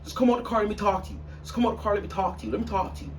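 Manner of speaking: mock the guy begging her out; imitating the boyfriend. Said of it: "He's like".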